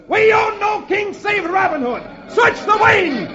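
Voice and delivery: very high battle shout. Acted. Sheriff's man to the Queen's Guard, high defiant shout. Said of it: "One of the sheriff's men shouts".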